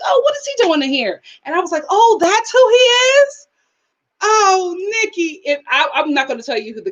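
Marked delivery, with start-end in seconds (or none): 0.0-1.2 high-pitched puzzled reaction; high, mildly puzzled recollection; curious surprise. Retold or imitated. Recalls her own surprised reaction. 1.9-5.4 high, drawn-out astonished reaction; loud, high disbelief sinking into self-reproach; shocked realization. Retold or imitated. Re-enacts realizing who he was.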